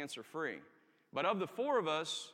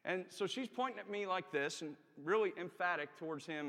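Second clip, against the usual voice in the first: ordinary talk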